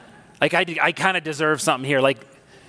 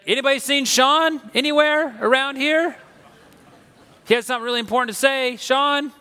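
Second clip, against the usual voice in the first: calling out; 7 semitones higher than usual. Retold or imitated. Pastor calling for missing speaker.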